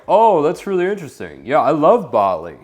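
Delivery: high, quick, overeager gush; eager people pleaser. Shown as a demonstration. Coach mocking the nervous guy's eager reply. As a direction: gush "Oh, that's really interesting" like you need her approval. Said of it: "It's not like that".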